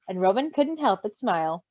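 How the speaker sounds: plain reading voice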